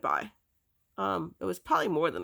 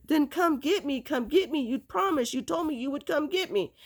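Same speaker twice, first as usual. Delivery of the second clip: high, insistent re-enacted demand; retold pleading; 8 semitones higher than usual. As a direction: plead and argue at raised pitch. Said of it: "arguing, and pleading with her".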